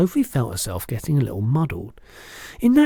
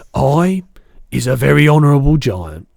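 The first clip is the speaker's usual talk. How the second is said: loud, higher, dignified giant declaration; proud BFG; 3 semitones higher, 10 dB louder than usual. A narrator's reading. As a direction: declare it grandly with pride. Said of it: "raised his head, great head proudly".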